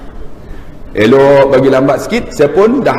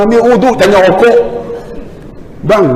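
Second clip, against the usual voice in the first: ordinary talk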